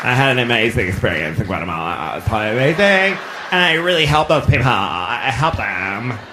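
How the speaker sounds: rushed, low mumbly gush that drags out at the end; mock a self-satisfied volunteer, running words together; gushing student, mocked. Student bragging about helping in Guatemala, mock gushing. Mocks returning school-trip student.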